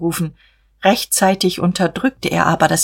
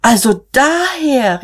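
slow, much higher and louder dawning realisation; surprised exclamation; 8 semitones higher, 4 dB louder, slower than usual. Acted. Sister realising where the inheritance came from, surprised exclamation. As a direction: draw it out as the penny drops. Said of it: "rief Magda".

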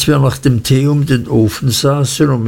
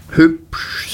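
higher-pitched one-word exclamation; exclaimed; 6 semitones higher than usual. A narrator's reading. Peggotty echoes praise of the boy's friend, exclaimed. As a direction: echo the word back as an exclamation. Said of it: "rief Mister Peggotty".

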